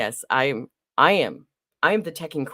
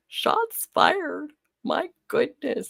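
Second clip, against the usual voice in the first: mock shock; 11 semitones higher, much slower than usual. Real, not acted. Mock exclamation.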